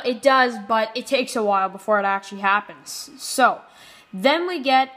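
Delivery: ordinary talk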